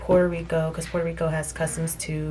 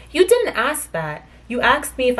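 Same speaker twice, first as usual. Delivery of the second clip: indignant retort; 6 semitones higher, 4 dB louder than usual. Retold or imitated. Indignant retort to customs officer.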